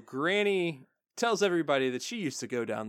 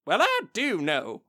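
indignant granny; 5 semitones higher, 4 dB louder than usual. Retold or imitated. Acts out Granny answering Applejack.

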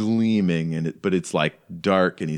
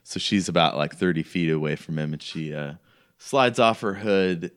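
normal talking voice

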